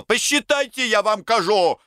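high, strained insistence; agitated demand. A narrator's reading. Semyon demands that money be counted, high agitated voice. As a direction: demand it at the top of your pitch.

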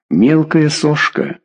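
презрительно и медленно; с лёгким презрением, неторопливо. A narrator's reading. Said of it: "презрительно сморщилась Дешкина". (презрительно, медленнее)

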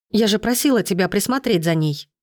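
ordinary delivery, nothing marked

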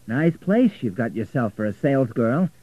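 loud, needling remark; a touch of angry sarcasm; angry jibe. A narrator's reading. Richard taunting Florentina about her flat, angry jibe. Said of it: "never seen Richard angry before".

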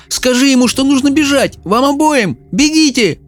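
high urgent shouting; desperate shout. A narrator's reading. Mel shouts at her mother to flee. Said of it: "крикнула Мел".

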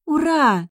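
high, loud cheer; joyful cry. A narrator's reading. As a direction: shout it out like an overjoyed girl. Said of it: "С радостным криком". (радостный крик)

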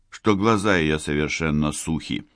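ordinary delivery, nothing marked

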